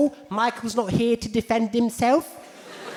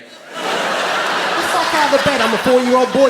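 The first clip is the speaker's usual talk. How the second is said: put-on frightened little boy; 4 semitones higher, 5 dB louder than usual. Boy answers in bed.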